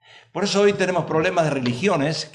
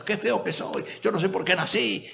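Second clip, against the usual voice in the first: laughing, higher self-pitying whine; mock paranoid lament; 4 semitones higher, 4 dB quieter than usual. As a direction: play the paranoid moaning at his mirror. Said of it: "¿usted es paranoico?". (lamento burlón y paranoico, riendo)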